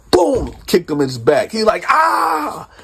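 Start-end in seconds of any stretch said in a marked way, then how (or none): 1.9-2.7 mock cry of pain. Cellmate cries out when kicked.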